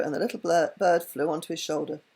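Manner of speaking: usual speaking voice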